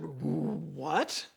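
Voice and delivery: low, soft, puzzled what, rising; baffled question. Retold or imitated. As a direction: ask it quietly as if retelling your bafflement. Acts out his baffled reply.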